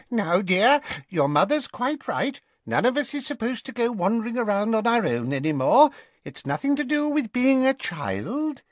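put-on high, soothing old dressmaker voice; high fluttery shopkeeper soothing a sulky boy; fussy shopkeeper. A narrator's reading. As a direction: fuss over him like a nervous seamstress. Madame Malkin voiced.

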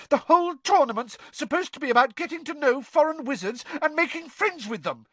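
very high, shrill argument; shrilly. A narrator's reading. Hermione lecturing Ron on the tournament, shrilly. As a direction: argue back at shrill pitch. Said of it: "said Hermione shrilly".

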